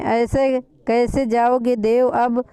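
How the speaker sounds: ordinary delivery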